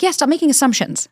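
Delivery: exasperated retold comeback; mock scolding.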